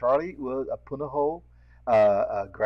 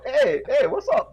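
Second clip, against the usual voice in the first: high, loud, excited hey; excited local reply; 12 semitones higher, 7 dB louder than usual. Retold or imitated. A local worker answers the test pigeon with excitement. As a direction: burst out glad to meet a fellow local. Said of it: "But if they go".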